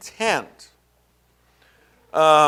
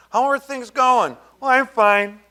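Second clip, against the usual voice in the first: mock bright churchgoer greeting, higher and louder; fake cheery reply; 8 semitones higher, 4 dB louder than usual. Preacher mimics Christians hiding misery, mock cheery. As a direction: put on a falsely cheerful front. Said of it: "you know, go".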